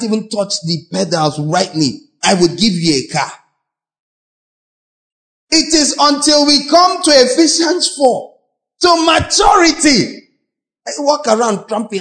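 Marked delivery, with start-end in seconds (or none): 5.5-10.3 preaching shout. Real, not acted. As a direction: build to a pulpit shout. Preaching climax.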